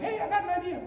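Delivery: squeaky-high, light exclamation; excited mock high voice. Priest waking from his dream with a plan, high and excited.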